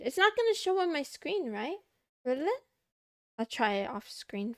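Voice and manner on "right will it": ordinary talk, nothing marked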